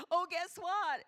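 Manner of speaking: very high, loud burst; excited, unguarded shout; openly announcing news. Shown as a demonstration. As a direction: blurt out news for anyone to hear. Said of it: "If it's news, you don't care".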